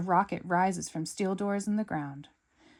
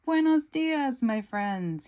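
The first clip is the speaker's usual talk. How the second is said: Eduardo; 7 semitones higher, 6 dB louder, slower than usual. Acted. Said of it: "he says".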